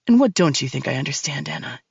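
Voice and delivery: low, cold male villain voice; deep and cold, taunting her; menacing man. Acted. Max's voice in story.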